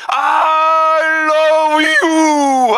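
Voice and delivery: Jesus crying love from the cross; crying shout. Acted. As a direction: wail the words out.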